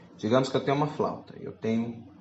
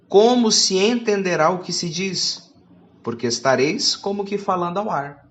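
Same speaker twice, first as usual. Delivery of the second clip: louder, declaiming Bible reading; scripture reading; 4 semitones higher, 6 dB louder, slower than usual. Acted. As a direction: proclaim the verse to the church. Reads scripture aloud.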